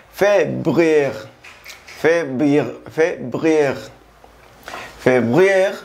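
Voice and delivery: Février with a throaty French r; Février repeated, r in the throat; Février à la française; French pronunciation. Shown as a demonstration. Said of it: "la R siempre es gutural".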